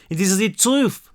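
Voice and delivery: put-on young French girl speaking careful English; French accent. A narrator's reading. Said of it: "she said in broken English".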